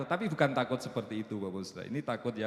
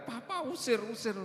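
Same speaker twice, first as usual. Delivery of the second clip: mock frightened wife; 12 semitones higher than usual. Husband imitating his wife begging him to chase the gecko, high mock squeal. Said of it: "udah teriak-teriak".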